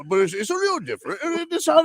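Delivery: high fake British sliding into Happy Gilmore; bad British accent. Host showing a different take, botched British accent. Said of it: "now you sound like Happy Gilmore".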